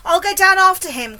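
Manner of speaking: loud, high, determined cry; cried out. A narrator's reading. James deciding to jump after the centipede, loud brave cry. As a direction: call it out bravely. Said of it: "cried James".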